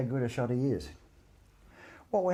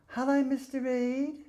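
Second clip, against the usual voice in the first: imitating him in a much higher, slow childlike voice; killer's voice; slower than usual. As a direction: mimic a meek man-child greeting. Witness imitates killer's greeting.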